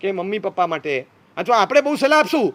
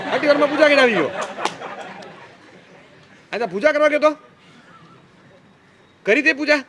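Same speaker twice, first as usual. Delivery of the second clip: mocking mother; 3 semitones higher than usual. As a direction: tease him for rushing the prayer. Mother scolding quick puja.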